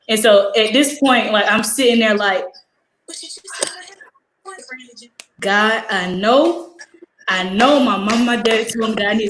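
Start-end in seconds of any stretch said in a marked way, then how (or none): 3.1-5.1 very soft, high murmur; acted-out protest kept under your breath; muted protest. Student sitting in class protesting to herself, high and hushed.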